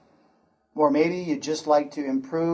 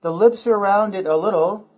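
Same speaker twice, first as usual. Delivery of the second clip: usual speaking voice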